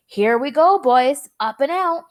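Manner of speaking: loud, rising cheer; gleeful announcement. A narrator's reading. Wonka announces the launch to the boys, loud and thrilled. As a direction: launch it with glee.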